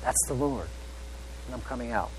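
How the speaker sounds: soft, hushed echo of the whisper; lightly hushed, reverent aside; hushed awe. Retold or imitated. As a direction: let it fall to a hush, awed. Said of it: "that whisper".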